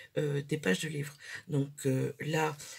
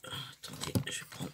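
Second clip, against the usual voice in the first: whispered; 6 dB quieter than usual. Real, not acted. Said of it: "whispered aside".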